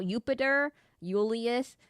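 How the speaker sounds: "Jupiter, Julius" said Yoo-piter, Yoo-lius. Shown as a demonstration. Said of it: "pronounced like a I".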